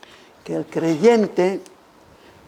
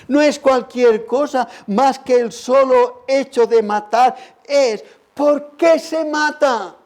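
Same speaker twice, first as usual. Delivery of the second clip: raised, insistent sermon pitch; fervent, high-pitched preacher's challenge; impassioned preaching; 6 semitones higher than usual. Acted. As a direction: press the questions home from the pulpit. Preaching at full force.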